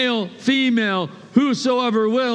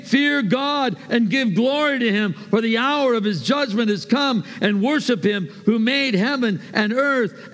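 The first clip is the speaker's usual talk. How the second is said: ordinary talk, nothing marked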